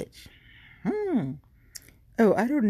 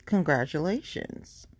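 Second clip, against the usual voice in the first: usual speaking voice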